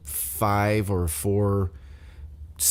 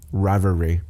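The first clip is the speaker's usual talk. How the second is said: normal talking voice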